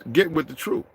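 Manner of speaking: ordinary talk, nothing marked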